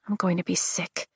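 ordinary delivery

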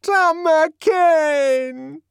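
very high, loud, long-held call; desperate cry. A narrator's reading. Linda running to throw her arms round him, desperate. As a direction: wail the name at full voice.

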